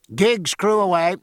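loud shipboard command; calling out. Acted. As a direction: bark it across the deck. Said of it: "called Jack".

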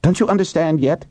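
high, exasperated retort; impatient snap. A narrator's reading. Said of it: "Wilson snapped".

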